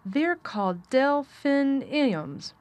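put-on slow, high, deliberate boyish announcement; boy proudly declaring, syllable by syllable; announcing. A narrator's reading. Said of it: "Colin was announcing".

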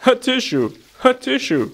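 high spoken "atishoo", falling; acted sneeze. A narrator's reading. Sheep sneezing among the petals, acted sneeze. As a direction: sneeze it twice as a word, high. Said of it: "sneezed Shona Sheep again".

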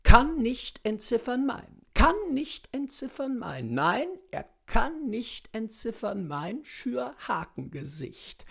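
usual speaking voice

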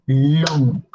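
"long" as one low, held sound; single drawn-out syllable. Shown as a demonstration. Teacher shows learners long is one sound, drawn-out syllable. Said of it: "One sound, one syllable".

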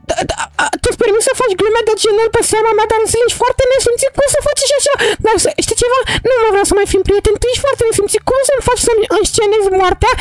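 put-on high, indignant girl voice telling him off; a hurt girl ending the friendship; offended girl character. Mara scolds Darusel for staging her death, high indignant voice.

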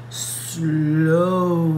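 usual speaking voice

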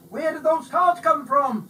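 high, loud eager shout. A narrator's reading. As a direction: shout over the crowd. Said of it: "someone shouted".

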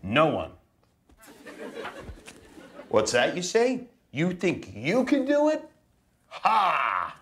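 a hint of a gloating goose; mock taunting goose. Dad voicing the goose daring the kids, mock gloating. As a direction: tease the kids like a smug goose.

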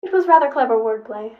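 loud robot self-defense; robot companion. A narrator's reading. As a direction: defend yourself loudly as a robot. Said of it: "Seven said".

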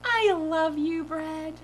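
high, slow, drawn-out adoring declaration; silly dream-self voice. Retold or imitated. Demonstrating how they talked to the bread, silly gushing voice. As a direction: stretch out the love, high and goofy. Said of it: "I sounded like that too".

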